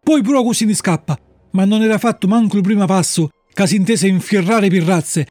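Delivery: ordinary delivery, nothing marked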